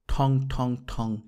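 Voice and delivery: slow, drawn-out imitation of a brass gong: thong, thong, thong; gong clang imitation. A narrator's reading. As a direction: ring out each ঠং like a distant hawker's gong. Narrator imitates hawker's gong.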